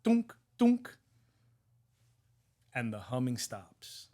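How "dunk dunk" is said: high, loud "dunk" noises; two high, spaced mock clunks; imitated hammer knocks. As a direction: mimic two taps of a small hammer. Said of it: "He goes".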